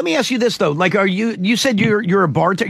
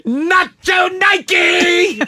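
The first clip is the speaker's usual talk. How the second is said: vendor shout; 10 semitones higher, much slower than usual. Retold or imitated. Hawking knockoff apparel.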